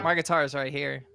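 ordinary talk

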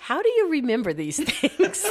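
asks through a laugh, high; laughing in amazed disbelief. Real, not acted. Wife laughing at her husband's memory, laughing.

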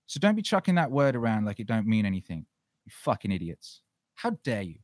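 usual speaking voice

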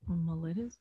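Mellitus with a short middle vowel, muh-LIT-us; trying another pronunciation. Shown as a demonstration. Reader unsure of the term tries Mellitus as muh-LIT-us, questioning. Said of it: "I don't know how to say it".

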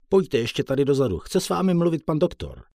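high, loud summons to the doctor; nurse calling. Acted. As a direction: call him back like a busy nurse. Nurse calls him to doctor.

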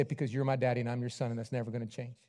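a hint of a small boy; small child answering. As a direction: son answering daddy quietly. Son answering father.

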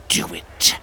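unvoiced, hissed command. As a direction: hiss the order in his mind. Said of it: "the voice hissed".

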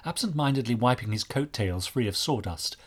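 usual speaking voice

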